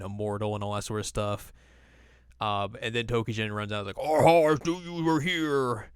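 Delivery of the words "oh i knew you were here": lightly hammed-up villain, drawn out, higher; villain impression. Villain taunts the heroes on arrival, hammed-up gloating. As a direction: stretch it out into a mock gloating laugh.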